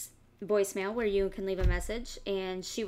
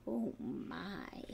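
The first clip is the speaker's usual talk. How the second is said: ordinary delivery, nothing marked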